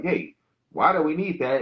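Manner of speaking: ordinary talk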